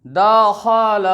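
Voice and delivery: दखल drawn out as daa-khaa-laa; slow, elongated दखल; wrongly stretched vowels. Shown as a demonstration. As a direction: stretch each syllable of दखल. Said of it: "ये गलत तरीका।".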